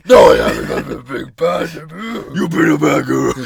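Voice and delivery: loud, strained demonic growl-shout; hammed-up possessed roar. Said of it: "Try to keep the demon contained".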